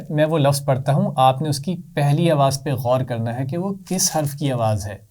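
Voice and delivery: ordinary talk, nothing marked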